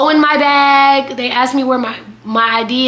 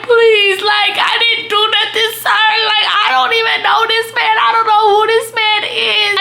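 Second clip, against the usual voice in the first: desperate pleading; 8 semitones higher than usual. Retold or imitated. On the ground begging the police, desperate tearful shout. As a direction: plead for your life, near tears. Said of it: "I'm on the ground like".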